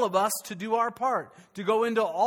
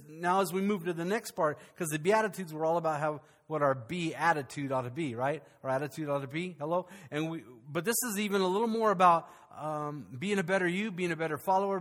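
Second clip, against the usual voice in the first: ordinary talk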